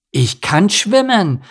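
high, slow, loud proud cry; a duckling announcing his triumph; high and loud, bursting with joy; excited. Acted. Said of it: "verkündete Enno begeistert".

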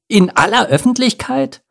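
high-pitched scandalized question; shrieking. A narrator's reading. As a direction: squawk it, appalled at the idea. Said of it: "kreischte Pogo". (schrill empört gekreischt)

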